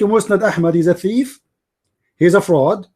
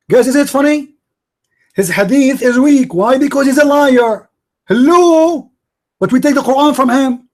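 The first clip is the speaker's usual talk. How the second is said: raised pitch, pointing out the absurdity; incredulous, higher-pitched appeal to the audience; incredulous mockery; 5 semitones higher than usual. Real, not acted. Debater shows the crowd a contradiction about hadith, incredulous mockery. Mocking opponent's contradictory position.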